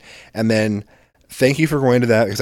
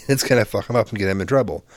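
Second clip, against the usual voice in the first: ordinary delivery, nothing marked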